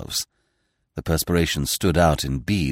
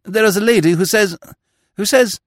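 high, flustered, stammering; 14 semitones higher, 6 dB louder than usual. Acted. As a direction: blurt it out breathlessly. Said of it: "stammered the attendant".

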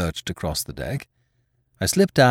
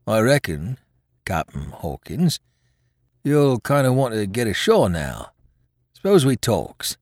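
wily seaman feeling out a deal; sly pirate sailor; 3 semitones higher than usual. Acted. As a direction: sound out the captain slyly, in sailor's dialect. Voices sly Hands proposing talk.

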